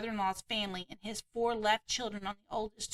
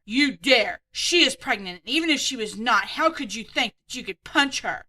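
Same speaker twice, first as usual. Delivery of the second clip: mock angry rebuke; 8 dB louder than usual. Husband stopping a punch.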